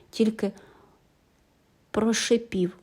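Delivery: plain reading voice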